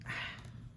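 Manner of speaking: soft, airy sigh; overwhelmed sigh. Real, not acted. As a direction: let out a quiet breath in awe. Overwhelmed by the card.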